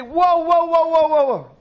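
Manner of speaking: hammed-up Jesus begging followers to come back; mock pleading call. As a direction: call the crowd back, half laughing. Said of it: "you would think Jesus would say".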